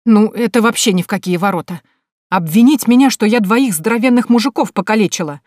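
loud, indignant outburst; angry. Acted. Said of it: "гневно произнесла я".

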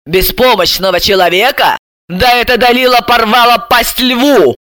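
scornful echo rising to outburst; indignant. A narrator's reading. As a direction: throw the words back, outraged. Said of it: "Бессарабова возмутилась".

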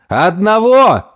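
high, drawn-out confirming shout; slow, stretched cheer; gleeful cry. Acted. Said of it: "засмеялись приятели".

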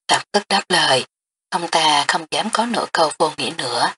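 ordinary delivery, nothing marked

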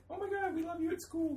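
high-pitched imitated gush; starstruck fangirl. New hire meeting her manager at McDonald's, starstruck. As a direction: squeal lightly like a starstruck new employee.

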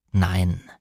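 low, firm man's denial; lightly voiced male character; Frank. A narrator's reading. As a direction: deny it low and steady. Frank denies it to her.

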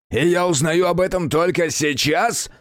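furious demon lord; enraged. A narrator's reading. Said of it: "взъярился Сатана".

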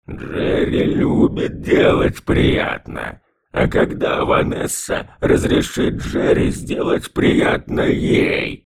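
slow, drawn-out gurgling whisper; drowned ghost. A narrator's reading. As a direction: stretch every sound, creepy and wet. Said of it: "растягивая буквы жутким, булькающим голосом". (жуткий булькающий голос)

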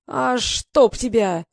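slow, drawn-out furious oath; outraged bandit cursing through mud; angry curse. Acted. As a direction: spit the curse out, dragging it. Muddy hat hits villain's face.

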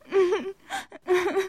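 short high wailing sob; a little girl bursting into tears; child starts crying. A narrator's reading. A young girl bursts into tears after upsetting her sisters, high wail. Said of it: "et se mit à pleurer".

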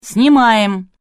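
loud, high shout with a drawn-out vowel; yelling. A narrator's reading. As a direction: yell it across the studio. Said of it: "шеф-редакторша заорала". (громкий крик на всю студию)

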